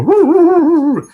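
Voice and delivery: loud, high "roo-roo-roo"; imitated dog howl. Imitating the dog begging for a rub, high howl. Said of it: "he'll do the".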